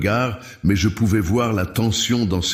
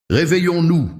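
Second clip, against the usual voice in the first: sharp, much higher and louder sour jab; sour retort; 10 semitones higher, 4 dB louder than usual. A narrator's reading. Julien retorts bitterly to Clara, sour and loud. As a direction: spit it back, bitter and mocking. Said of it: "rétorqua-t-il, aigre".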